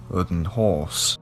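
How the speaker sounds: plain reading voice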